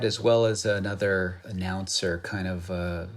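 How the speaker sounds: usual speaking voice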